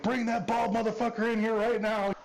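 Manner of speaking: high-pitched mock yell of a cussing man; retold screaming. Locked-up man yelling at the sergeant, mock high yell. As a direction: imitate the locked-up guy hollering insults. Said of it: "this guy's screaming and cussing".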